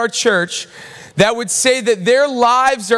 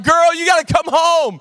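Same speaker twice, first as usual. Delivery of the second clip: urgent shout; 7 semitones higher than usual. Retold or imitated. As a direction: blurt it out in a panic. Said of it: "I'm like".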